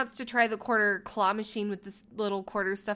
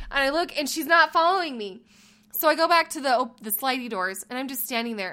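ordinary talk

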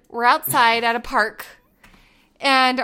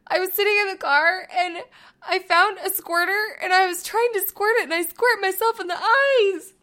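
a hint of a small child, pitched higher; lightly voiced little boy; 7 semitones higher than usual. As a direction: retell it as her son would, a bit higher. Quoting her son's story.

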